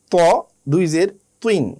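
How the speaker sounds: ordinary talk, nothing marked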